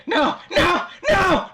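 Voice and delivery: high panicked shouts; terrified scream. Acted. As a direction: scream in terror. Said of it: "rat screaming as axe falls".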